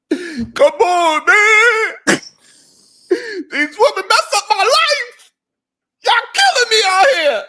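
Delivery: high, loud, whiny mock sob; imitating a crying man begging for sympathy. Host mimics Joseph crying on a show, high wailing mock sob. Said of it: "He was crying up there".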